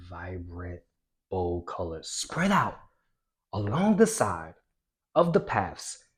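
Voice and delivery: cartoonish storytelling, big swings from loud to whispered; animated reading. Shown as a demonstration. Teacher showing students a lively reading, cartoonish and animated. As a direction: read it like a silly cartoon for kids. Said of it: "the way I read that ... be crazy, be cartoonish".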